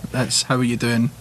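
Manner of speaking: ordinary delivery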